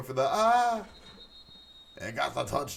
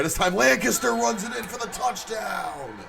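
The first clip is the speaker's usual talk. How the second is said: high, loud touchdown shout; excited call; 12 semitones higher, 7 dB louder than usual. Real, not acted. Commentator calling the touchdown, excited shout. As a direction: sell the score big.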